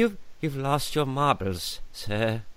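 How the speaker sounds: slow, soft put-on character voice; Hook film character. Repeating the Hook line for co-host, slow soft impression. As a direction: say it softly, drawing out the words. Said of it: "reminds me of Hook when he's like".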